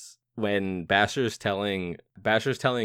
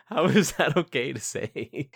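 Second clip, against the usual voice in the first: higher, slow, laughing disbelief; incredulous; 6 semitones higher, slower than usual. Real, not acted. Reacting to a film doctor, slow and incredulous. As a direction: drag out the outrage through a laugh.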